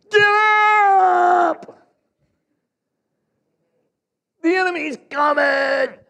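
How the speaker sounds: very high, loud yell, slow on the enemy's coming; full alarm shout. Acted. Sentry yelling at sleeping troops to wake, full alarm shout. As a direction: scream the alarm to wake everyone. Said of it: "Did I sound the message there".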